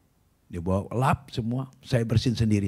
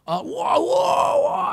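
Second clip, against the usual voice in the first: high-pitched mock howls; imitated screaming in pain; 3 dB louder, much slower than usual. Troublemaker screams as the hymn continues, high mock howling. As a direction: wail high, mimicking someone in agony. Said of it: "teriak-teriak".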